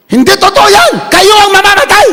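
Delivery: high, loud defiant yell; defiant shout. Retold or imitated. Wife defies the kidnappers on the phone, loud defiant shout. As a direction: hurl it back at the kidnappers. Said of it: "Anong sabi niya".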